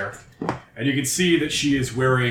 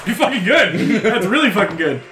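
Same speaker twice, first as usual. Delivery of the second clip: excited; 8 semitones higher, 6 dB louder than usual. Real, not acted. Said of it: "GM impressed by the play".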